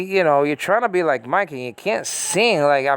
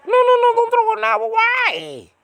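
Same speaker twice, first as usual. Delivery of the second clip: much higher, louder, put-on squeaky voice; a high cartoonish voice begging to keep junk; mock squeaky hoarder; 3 dB louder than usual. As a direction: play the clingy hoarder, shrill and pleading.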